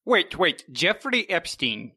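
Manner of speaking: ordinary talk, nothing marked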